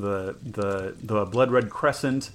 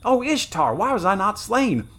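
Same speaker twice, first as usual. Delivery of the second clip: much higher and louder, crying out; anguished soldier; 10 semitones higher, 6 dB louder than usual. Acted. Wounded soldier crying out at his queen's betrayal, anguished. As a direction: wail it like a betrayed guardsman. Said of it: "like one still stunned by some monstrous".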